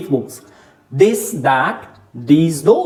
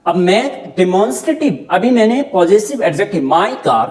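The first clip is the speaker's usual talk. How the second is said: usual speaking voice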